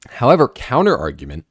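loud, slow, declaiming delivery; emphatic announcer read. Acted. Host reading a fun fact to viewers, loud emphatic announcer. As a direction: read it out loudly with drawn-out emphasis.

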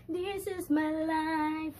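high, loud, long-drawn-out declaration; exuberant proclamation. Real, not acted. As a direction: stretch every word out high and proud. Exuberant repeat after laughing.

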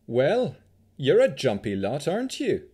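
put-on high, loud Vistani accent. A narrator's reading. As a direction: tease lightly in a Vistani accent. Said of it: "she said with a Vistani accent".

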